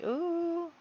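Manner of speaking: high, soft mocking ooh from the crowd; onlookers' ooh. Guests watching the couple's scandal, high mock ooh. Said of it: "everyone's like".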